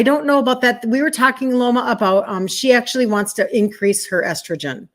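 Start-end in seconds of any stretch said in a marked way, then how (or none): none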